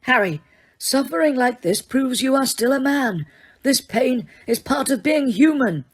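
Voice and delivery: a calm, lightly voiced old wizard; Dumbledore, calm. A narrator's reading. Headmaster reassuring Harry, calm old wizard voice.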